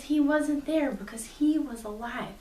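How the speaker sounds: normal talking voice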